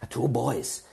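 put-on German-accented wolf mother; old German woman. Old woman tells of raising sons, German accent. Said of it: "it's like she's a wolf mother".

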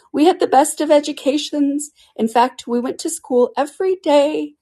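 high, loud turtle voice; Mock Turtle bragging to Alice, high and loud; proud Mock Turtle. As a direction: boast about his schooling.

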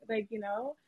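ordinary talk

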